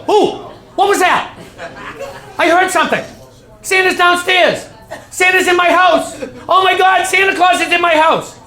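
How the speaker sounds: high, loud breathless excitement; excited kid. Acted. Boy in bed hearing noises, loud high excitement. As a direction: squeal that Santa is in the house. Kid hears Santa at night.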